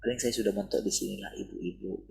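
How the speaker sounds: quiet, resigned mock self-talk; self-belittling. Preacher mimics a mother belittling herself, quietly resigned. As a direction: voice a small, self-doubting murmur. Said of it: "Jangan lagi pandang dirimu".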